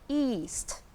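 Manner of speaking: east with a drawn-out ee; long, falling ee in east. Shown as a demonstration. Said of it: "pronounce east with a long E".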